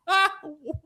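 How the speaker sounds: high, short disbelieving laugh; incredulous laugh. Real, not acted. Laughs at the contract.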